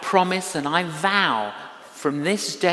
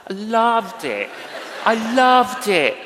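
laughing and calling it out louder; laughing joy; 5 semitones higher, 4 dB louder than usual. Real, not acted. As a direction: laugh through it, bursting with real delight. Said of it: "I was a barista".

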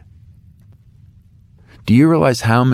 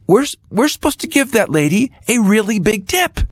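put-on higher, eager young voice urging dad; son's eager plea; 10 semitones higher than usual. Son leaning over urging his dad at the table, higher eager voice. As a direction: plead like a boy nudging his father. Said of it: "He said".

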